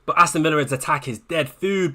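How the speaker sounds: ordinary talk, nothing marked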